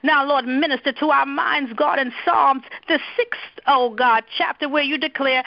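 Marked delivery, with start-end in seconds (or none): none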